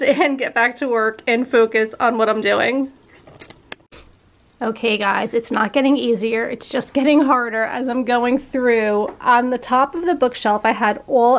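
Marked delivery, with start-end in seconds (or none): none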